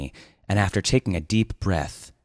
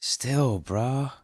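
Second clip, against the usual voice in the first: doubtful; 6 semitones higher than usual. Acted. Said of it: "shaking his head doubtfully".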